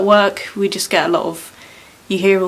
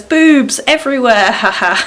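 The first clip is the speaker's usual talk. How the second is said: cheeky quote; 6 semitones higher, 7 dB louder than usual. Retold or imitated. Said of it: "And then someone says".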